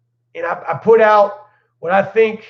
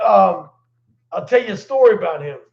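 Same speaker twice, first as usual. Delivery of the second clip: usual speaking voice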